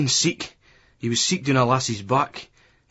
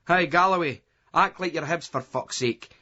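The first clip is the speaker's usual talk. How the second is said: mocking jeer; 6 semitones higher than usual. A narrator's reading. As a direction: rib your drunk mate. Terry jeering at Gally.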